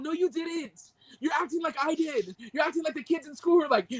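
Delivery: high-pitched indignant rebuttal; worked-up scolding without shouting; mad. Real, not acted. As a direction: call out the lie, exasperated. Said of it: "I'm mad".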